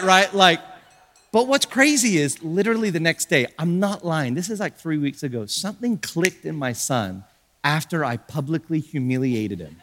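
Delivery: ordinary delivery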